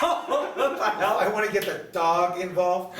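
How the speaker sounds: slow, high, loud and laughing; laughing disbelief. Real, not acted. Repeating a friend's shocking question, laughing slowly.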